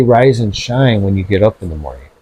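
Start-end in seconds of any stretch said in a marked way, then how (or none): 0.0-1.0 slow rise and shine. Shown as a demonstration. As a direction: draw out "Rise and shine" gently and deliberately.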